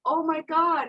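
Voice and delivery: high-pitched mock alarm; cute freak-out. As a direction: imitate his cute little panic. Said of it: "freaking out in the cutest way".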